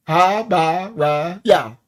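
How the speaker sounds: "Habariya" slowed into ha-ba-ri-ya, each beat separate; slow syllable demo. Shown as a demonstration. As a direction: say "Habariya" slowly so every syllable drops the jaw. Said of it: "How many times?".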